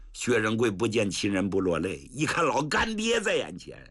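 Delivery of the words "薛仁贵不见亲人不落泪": usual speaking voice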